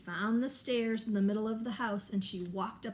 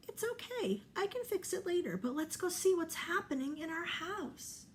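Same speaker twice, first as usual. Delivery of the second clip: comforting mama bear; 9 semitones higher than usual. Acted.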